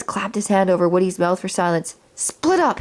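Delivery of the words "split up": ordinary delivery, nothing marked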